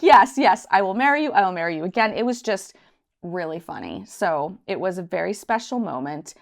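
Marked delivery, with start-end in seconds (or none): 0.0-2.1 loud, laughing yes, yes. Retold or imitated. Accepting the proposal again, a loud laughing yes. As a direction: burst out with yes through laughter. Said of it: "I'm like".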